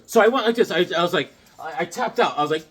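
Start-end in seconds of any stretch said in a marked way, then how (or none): none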